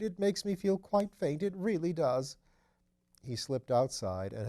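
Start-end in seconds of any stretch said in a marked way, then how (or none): 0.0-2.4 feeling faint. Acted. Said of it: "he murmured".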